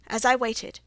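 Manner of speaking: plain reading voice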